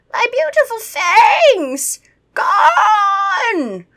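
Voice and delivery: high, loud, slow vampire wail with a sob; hammed-up vampire despair; vampire wails over his lost fangs. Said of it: "That's my vampire voice".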